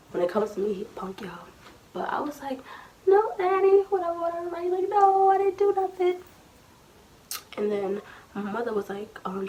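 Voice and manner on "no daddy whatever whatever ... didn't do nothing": high mock-whining denial; retold crying. Child denying it to daddy, mock-crying whine. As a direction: plead in a high whiny child voice. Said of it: "I started crying".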